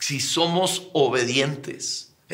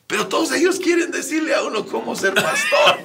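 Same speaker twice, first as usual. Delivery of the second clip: laughing; 7 semitones higher, 3 dB louder than usual. Real, not acted. Riendo mientras se queja.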